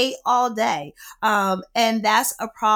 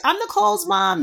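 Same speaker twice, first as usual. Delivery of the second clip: high-pitched mom introducing herself; sing-out mock-mom voice, pitched up; Teresa; 10 semitones higher than usual. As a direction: imitate a mother announcing herself to strangers. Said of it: "And then she's like".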